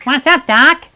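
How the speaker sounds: light Bugs Bunny quip; teacher demos a cartoon catchphrase, lightly voiced. Shown as a demonstration. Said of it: "Bugs Bunny goes".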